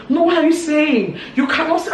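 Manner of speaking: high, loud agitated outburst; vibrating with rage. Retold or imitated. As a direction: imitate someone worked up and shouting. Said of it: "it was really vibrating".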